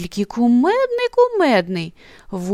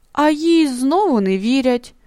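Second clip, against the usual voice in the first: ordinary delivery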